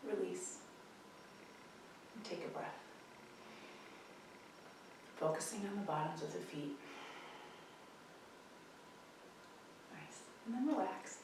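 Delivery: quiet, unhurried breathing cues; calm tai chi cool-down guidance; soft guided breathing. Acted. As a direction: ease them into rest. Guides breath after movement set.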